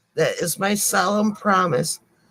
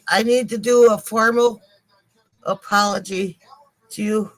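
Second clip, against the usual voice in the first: ordinary delivery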